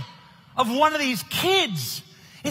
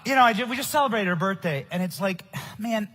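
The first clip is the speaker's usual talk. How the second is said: ordinary talk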